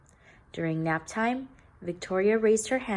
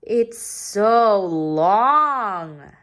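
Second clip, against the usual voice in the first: louder, very slow mocking voice; teasing boy; 3 semitones higher, 8 dB louder, much slower than usual. A narrator's reading. Joe teasing her about her long name. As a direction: drag it out to mock. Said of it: "said Joe".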